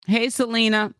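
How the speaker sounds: normal talking voice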